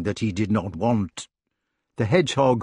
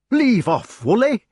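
high, indignant rustic challenge; demanding hedgehog; 11 semitones higher, 5 dB louder than usual. A narrator's reading. Hedgehog confronting the committee for his hero, demanding. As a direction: order them off in broad dialect. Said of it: "he demanded".

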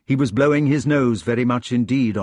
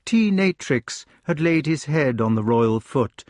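usual speaking voice

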